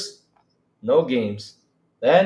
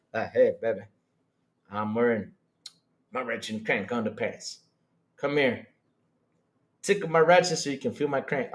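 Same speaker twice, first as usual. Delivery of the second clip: low, soft come-on; mock seduction; 6 semitones lower, 5 dB quieter than usual. Streamer spoofing an underpants come-on, mock seductive. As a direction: play a hammed-up sweet-talker, voice dropped.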